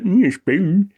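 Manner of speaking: high, loud yokel impression of mm spoon; mock rural local, hammed up; West Country yokel. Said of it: "he's like".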